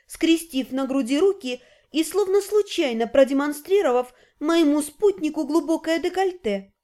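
usual speaking voice

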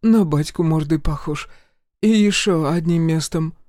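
высокий суетливый голос матери; мать суетится, заглаживая обиду. A narrator's reading. Mother voiced high. (высокий хлопотливый голос матери)

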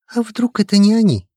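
high, eager questioning voice; worried young colleague; anxious colleague. A narrator's reading. Lukin's voice. (встревоженный голос)

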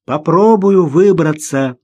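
loud, slow, effortful call; shout. A narrator's reading. Man in the water will try climbing, shouting. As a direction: call out high, drawing the words out. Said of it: "крикнул он".

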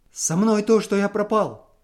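a ruined man blurting out his doom; despairing confession. A narrator's reading. Husband confesses to his wife that he is ruined, despairing.

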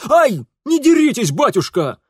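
high, loud pleading yelp; pained yelp. Acted. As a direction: cry out as the blows land. Son yelps, struck by father.

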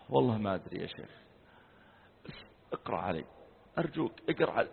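soft, tearful pleading; mild imitation of a weeping plea; imitating crying student. Student begs the sheikh to recite over him, softly tearful. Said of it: "وبدأ يبكي".

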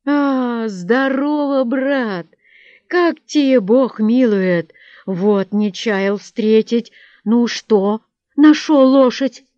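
loud, drawn-out hearty greeting; happy, booming hail; joyful greeting. A narrator's reading. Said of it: "произнёс радостно".

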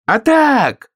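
very high, loud whoop; yelling. Acted. Hare outdoes the other and walks on ears, yelling. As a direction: yell it at the top of the voice. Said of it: "завопил заяц".

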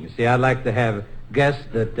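ordinary talk

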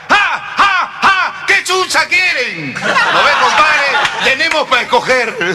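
high, loud cackling shout; high mock-tough nun yelling with laughter; tough karate nun. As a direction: cackle and bark like a brawling nun. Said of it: "viene la madre karateca".

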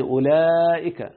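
أولئك with the middle alif drawn out long; long madd. Shown as a demonstration. Teacher showing the lengthened plural dialect form, slow long madd. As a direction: hold the second syllable of أولئك, then a short hamza. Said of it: "في لغة من مده".

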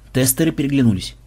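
ordinary delivery

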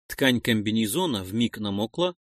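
ordinary delivery, nothing marked

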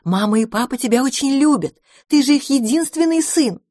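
insist, pitching it up; earnest, scandalized lady character; indignant. A narrator's reading. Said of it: "возмутилась госпожа Куцехвост".

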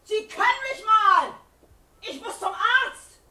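loud, desperate defiance at the guards; defiant shouting. Acted. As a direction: push past them yelling. Mother defies guards to fetch doctor.

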